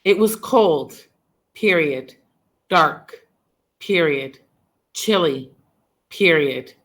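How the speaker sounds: each word set apart by pauses, every "period" spoken with a falling tune; word-by-word dictation, "dark" and "chilly" each falling and standing alone; dictated sentence fragments. Shown as a demonstration. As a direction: dictate "cold", "dark", "chilly" one at a time, saying "period" after each. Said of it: "had the sentence like this".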